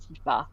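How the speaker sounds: Tifa as tee-FAH, weight at the end; French final-syllable stress on Tifa. Shown as a demonstration. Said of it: "technically in a very French way".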